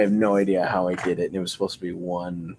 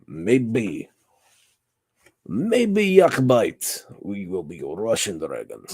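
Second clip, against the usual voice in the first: playful Russian dragon with swooping pitch; Russian accent. Pretend. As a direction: put on a mock Russian accent. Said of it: "Did I not hold my Russian accent".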